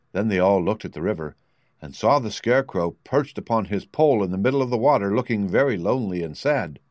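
plain reading voice

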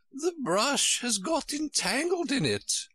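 high, woeful royal voice; high-pitched, helpless lament; sighing Queen. A narrator's reading. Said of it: "the Queen said with a sigh".

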